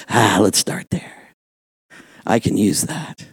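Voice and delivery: low, slow, softened voice; God's gentle reply. Acted. God answering a confessed weakness, gentle and low. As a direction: answer low and unhurried, as if accepting the offer.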